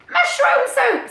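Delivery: high, loud cheery squirrel voice; bright squeaky animal character. Acted. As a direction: pitch it up as the excited squirrel. Character voice in read story.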